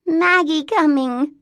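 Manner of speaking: put-on high, loud, slow child voice; toddler voice. A narrator's reading. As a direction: announce it like a small child. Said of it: "said the toddler".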